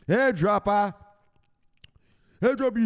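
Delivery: high, loud nickname call; hype shout. Pretend. Introducing the next guest by nickname, loud high shout. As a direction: holler it like a fight introduction.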